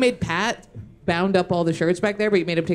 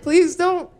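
mock pleading; 11 semitones higher, 5 dB louder than usual. Retold begging a friend, through laughter.

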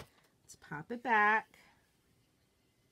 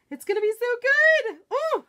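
much higher and louder, squealing with delight; thrilled; 14 semitones higher, 8 dB louder than usual. Real, not acted. Crafter almost done with her project, thrilled squeal. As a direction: squeal it out, bursting with glee.

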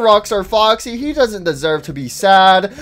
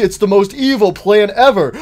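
boastful villain line into high, loud cackle; gloat, then burst into villainous laughter; mock-evil scheming cackle; 4 semitones higher, 4 dB louder than usual.

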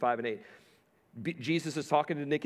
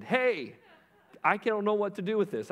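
high, louder mock call across the room; retold yell; 8 semitones higher, 3 dB louder than usual. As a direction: call the manager over, half-yelling. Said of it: "She yelled over to the manager".

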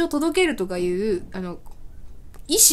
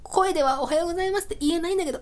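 high, small aside to someone far away; hurried, high-pitched stand-in for gestures; voiceless apology; 7 semitones higher, faster than usual. Retold or imitated. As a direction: apologize as if miming across the room.

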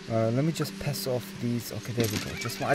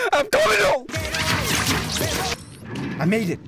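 tearful father; 8 semitones higher than usual. Acted. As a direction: cry it out as the overjoyed dad. Emotional reunion, father voiced.

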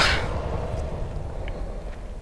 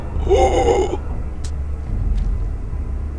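sharp frightened gasp; 10 dB quieter than usual. Real, not acted. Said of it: "I'm legit scared".

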